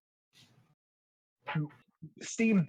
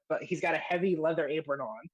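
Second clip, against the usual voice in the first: normal talking voice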